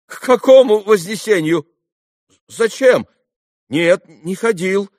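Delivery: high, loud bewildered questions; taken aback. A narrator's reading. Lad thrown by the boy's odd question, taken aback. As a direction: answer in startled confusion. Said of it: "опешил немного парень".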